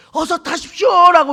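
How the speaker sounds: 높은 음으로 끝을 길게 빼며 외치기; 다급히 부르는 구조대원 흉내; 외치는 구조대원. Retold or imitated. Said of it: "외치자". (높게 외치는 구조대원 흉내)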